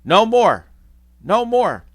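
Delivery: high, loud desperate cry. Acted. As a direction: cry out to make it stop. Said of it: "Scrooge cries out".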